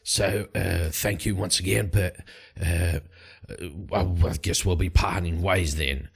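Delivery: low, measured Light voice; Light's voice. Acted. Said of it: "And Light turns to them and goes".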